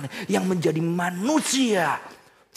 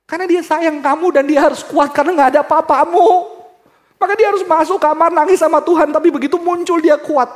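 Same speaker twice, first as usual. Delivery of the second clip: loud, high pleading sermon voice; fervent preaching; 14 semitones higher, 13 dB louder than usual. Acted. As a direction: plead with the children on the mother's behalf. Preacher speaks to the children.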